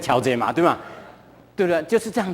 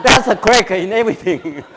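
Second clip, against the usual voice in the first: slow and louder, laughing through it; slow, deliberate reading; 6 dB louder than usual. Shown as a demonstration. Proving his English to the congregation, slow and louder with laughter. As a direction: read it again slowly, savouring every word. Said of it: "我再念一次英文给你听".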